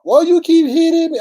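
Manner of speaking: very high, loud mocking wail; shrieky imitation of a crybaby; crybaby whining. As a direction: mimic a sore player complaining. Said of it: "You cry about every single solitary thing".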